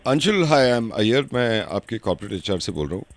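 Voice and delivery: low-voiced HR manager. HR manager phoning an employee about leave, a low formal voice. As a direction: sound like a polite senior officer.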